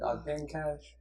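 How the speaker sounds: softly imitated soothing teacher. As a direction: say it gently, as the monotone teacher. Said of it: "he has the most soothing voice".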